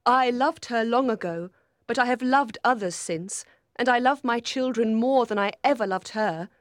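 bitter, high-voiced questioning. A narrator's reading. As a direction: reproach him with wounded bitterness. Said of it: "she said bitterly".

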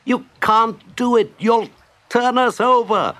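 high-pitched protesting cry; alarmed cry. A narrator's reading. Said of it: "cried Rat from the bottom of the boat".

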